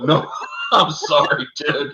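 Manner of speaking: high apologetic words through laughter; laughing apology. Real, not acted. Host laughing as he apologizes to the chat. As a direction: apologize while still laughing.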